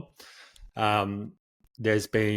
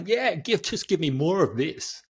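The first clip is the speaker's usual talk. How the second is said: high, excited mock enthusiasm; eager change-lover; 8 semitones higher than usual. Describing people who run toward change, in high eager excitement. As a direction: play someone hungry for more change.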